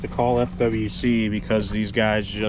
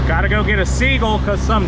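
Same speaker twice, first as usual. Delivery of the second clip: urgent shout; 11 semitones higher, 7 dB louder than usual. Real, not acted. Furious at bird abuse on boat.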